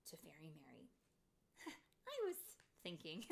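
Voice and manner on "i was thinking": mildly nervous, with a little laugh; hesitant, broken by nervous giggles. A narrator's reading. Tink tentatively asks Fairy Mary, nervous. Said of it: "said Tink nervously".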